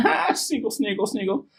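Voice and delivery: high giggly sniggle; sniggling. Acted. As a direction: spell out the snigger playfully. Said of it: "sniggled again".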